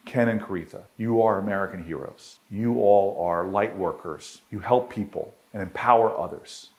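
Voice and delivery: solemn closing address. Acted. Speaker closing his speech to the Stanford audience solemnly.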